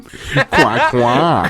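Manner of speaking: laughing mimicry of an American duck; American-accented quack. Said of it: "Why did he go American?".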